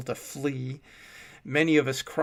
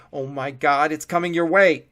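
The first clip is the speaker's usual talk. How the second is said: panicked warning; 3 semitones higher, 11 dB louder than usual. A narrator's reading. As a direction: warn them in panic. Sobbing man warns in panic.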